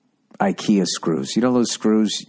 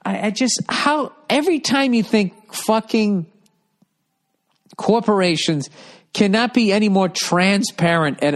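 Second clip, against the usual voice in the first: exasperated disbelief; 10 semitones higher than usual. Real, not acted. Exasperated rant about corporate euphemisms.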